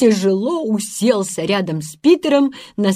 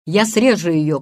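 ordinary delivery

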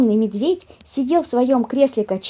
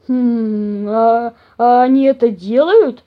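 loud, slow, wondering bear voice; surprised bear; 6 dB louder, slower than usual. A narrator's reading. Papa bear learning animals eat each other, surprised. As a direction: hum and stumble into a puzzled question. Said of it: "удивился папаша Бамсе".

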